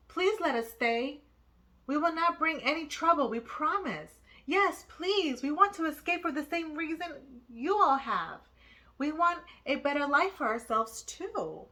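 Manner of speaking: put-on a young refugee pleading with passengers; pleading boy. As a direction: plead to be allowed to stay. Jung begs to stay aboard.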